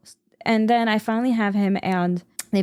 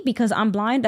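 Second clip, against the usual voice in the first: ordinary talk, nothing marked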